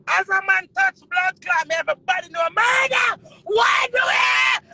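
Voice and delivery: high, loud, shouting rant; shouting at the top of her voice; furious shout. Real, not acted. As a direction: yell it out in real fury.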